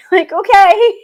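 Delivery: high, loud incredulous "okay"; hammed-up disbelief, pitch leaping up; mock exasperation. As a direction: throw up an exaggerated, laughing protest.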